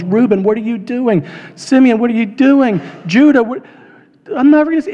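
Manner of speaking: put-on pleading yell of a betrayed boy; desperate screaming. Young Joseph sold into slavery, crying to his brothers, frantic scream. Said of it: "He is screaming at the top of his lungs".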